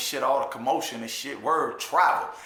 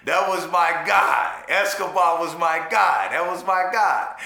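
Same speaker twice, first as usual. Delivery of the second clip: louder, slower hype for his guy; proud shout; 4 dB louder than usual. Real, not acted. Bigging up his buddy Escobar, loud and proud. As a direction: shout out a friend with pride.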